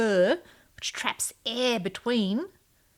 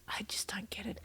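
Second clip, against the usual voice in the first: much softer whispered bafflement; whispered aside; 16 dB quieter than usual. Real, not acted. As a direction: whisper it like a confession. Mock-baffled aside on pickleball.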